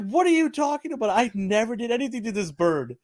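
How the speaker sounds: high, incredulous retold protest, easing down toward the end; mock indignation. Narrator answers his friend's accusation of harming the bird, mock indignation. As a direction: deny it, starting high in disbelief.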